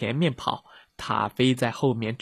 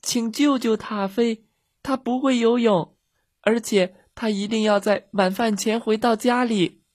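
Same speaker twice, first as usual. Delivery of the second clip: put-on high-pitched child's plea; loud calling; 9 semitones higher than usual. A narrator's reading. Girls begging for the dog's rescue, calling loudly. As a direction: plead for help in a high, urgent voice. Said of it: "米莉和茉莉大声喊道".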